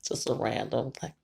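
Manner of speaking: ordinary talk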